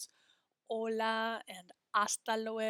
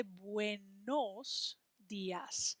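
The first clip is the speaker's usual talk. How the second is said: buenos dias said slowly; slow correct model; much slower than usual. Shown as a demonstration. Teacher slowly modelling the correct greeting. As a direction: stretch the correct phrase. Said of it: "we need to say".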